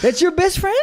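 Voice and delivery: mock disbelief. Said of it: "mocking disbelief".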